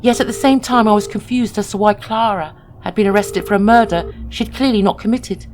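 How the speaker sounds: ordinary delivery, nothing marked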